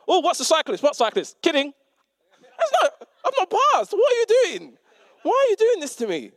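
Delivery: high, loud panicked squeal at the wheel; half-laughing, hammed-up driving panic; mock panic. Learner driver yelping at the instructor, mock panic.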